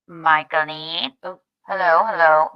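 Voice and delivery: loud call through a cheap megaphone; toy megaphone. Acted. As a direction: call her name over a toy bullhorn. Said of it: "So I could go like that".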